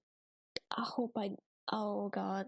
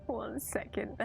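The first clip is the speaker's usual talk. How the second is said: whispered laughing; 5 semitones higher, 4 dB quieter than usual. Real, not acted.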